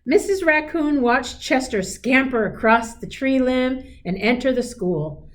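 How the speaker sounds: gentle storybook read-aloud; a teacher reading a picture book aloud; reading to children. A narrator's reading. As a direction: read it like a bedtime story to a class.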